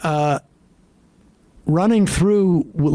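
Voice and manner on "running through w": normal talking voice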